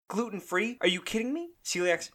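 high, incredulous scoff; mock disbelief. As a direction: act like you can't believe your ears. Mocking the gluten-free trend.

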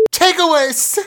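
put-on high, loud whiny kid yelling a name; angry child. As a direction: shout it like a cross little boy. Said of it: "a clip of your child being angry".

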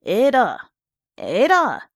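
loud, rising-falling bellow; bawling. A narrator's reading. Husband bawling upstairs for his wife, loud. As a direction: bawl it up the stairs. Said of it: "He bawled upstairs".

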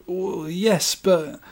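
high, soft, hesitant protest; timid sales assistant. Acted. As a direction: meekly try to get a word in. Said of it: "Him to sales assistant".

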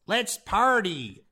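lightly sketched politician impression, drawn out; a hint of a politician, words stretched; Rand Paul. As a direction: toss off a slow, token impression. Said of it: "Rand Paul was just like".

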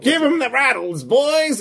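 high rattling skeleton gangster call; skeletal voice. Acted. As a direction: bark it like a bony mobster. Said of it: "in a skeletal voice".

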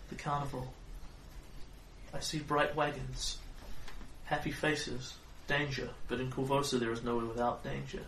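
hushed mystic reading; fortune teller. Acted.

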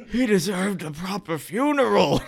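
high, put-on protesting voice; horrified knight. Knight watches the body burn, horrified protest.

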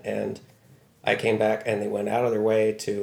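ordinary talk, nothing marked

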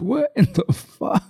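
high, slow mock disbelief; retold bewilderment, pitched well up; baffled reaction. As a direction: draw it out high, lightly stunned.

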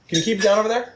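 high, loud call to the noisy room; cranky neighbor. Pretend.

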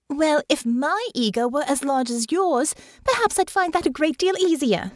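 high young voice, tart and mocking; Honor, sarcastic retort. A narrator's reading.

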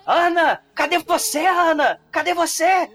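hammed-up anxious calling. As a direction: call her name up high as if searching the house. Tarcísio calling for Ana.

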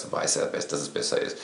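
quiet suggestive murmur. Retold or imitated. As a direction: drop to a near whisper on "Du weißt ja, dass es besser ist.".

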